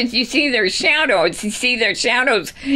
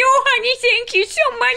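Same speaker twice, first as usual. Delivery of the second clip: high, gushing wife voice; excited wife voice; 10 semitones higher than usual. Acted. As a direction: squeal your thanks like a thrilled wife. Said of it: "especially his wife".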